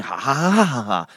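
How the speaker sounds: hammed-up rising ha-ha-ha; Pharaoh's gloating laugh. Preacher voicing Pharaoh's scheming, mock gloating. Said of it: "When Pharaoh is thinking".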